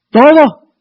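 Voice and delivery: sudden loud, high burst; forceful demand. Acted. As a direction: thunder it out as an absolute demand. Same demand repeated at full force.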